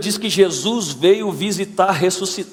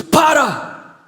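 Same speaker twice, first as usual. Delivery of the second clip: sudden high shouted command; powerful voice of God; 11 semitones higher, 5 dB louder than usual. Retold or imitated. God interrupting a self-pitying prayer, powerful commanding shout. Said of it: "e Deus diz".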